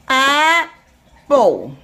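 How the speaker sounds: "Apple" broken into two slow beats; "Apple" sounded out syllable by syllable; split into syllables. Shown as a demonstration. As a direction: say "Apple" as a long "ap", a pause, then "pull". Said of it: "Two syllables".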